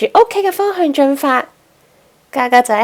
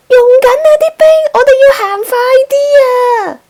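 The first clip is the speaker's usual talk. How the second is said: much higher, louder, fast and tearful; panicked yell; 13 semitones higher, 5 dB louder, much faster than usual. A narrator's reading. Penguin captain yelling to his friend as the ice melts, panicked. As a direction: cry out in panic, near tears. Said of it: "嘉嘉大叫話".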